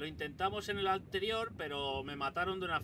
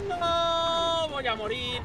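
1 grito de pánico; 12 semitones higher, 9 dB louder, much slower than usual. Real, not acted. A punto de morir.

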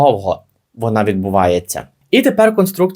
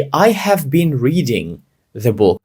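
slow read; slower than usual. Shown as a demonstration. Teacher reads English example.

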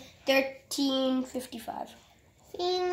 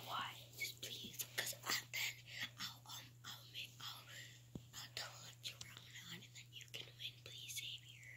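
whispering; 18 dB quieter than usual. Real, not acted. Begging friend secretly while hiding.

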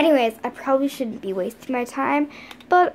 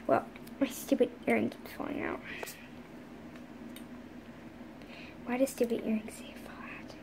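fast, breathy whisper under the breath; whispered grumble; 3 semitones lower, 13 dB quieter, faster than usual. Pretend. Toy fussing over her earring, soft fast whisper. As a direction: hiss the complaint to yourself. Muttering to self about earring.

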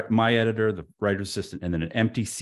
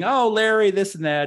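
gushing imitation; 12 semitones higher, 6 dB louder than usual. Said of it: "she's laughing".

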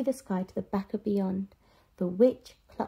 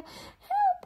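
very high scream of Help!; cry for help. Acted. Witch calls for help, very high panicked cry. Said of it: "cried the witch".

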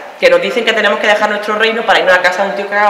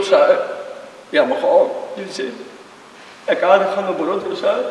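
put-on jealous boyfriend voice; 5 semitones lower than usual. Boyfriend talks girlfriend out of dancing, in a low coaxing voice. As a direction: coax her like a possessive boyfriend.

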